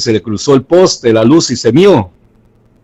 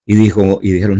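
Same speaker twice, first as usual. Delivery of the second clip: ordinary talk, nothing marked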